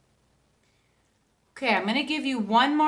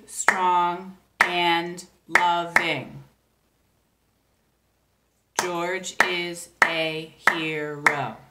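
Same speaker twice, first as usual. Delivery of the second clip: George poem spaced out very slowly, syllable by syllable; clapped syllables; much slower than usual. Shown as a demonstration. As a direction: tap out each beat slowly. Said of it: "If you clap out those syllables".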